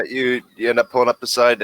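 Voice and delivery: ordinary delivery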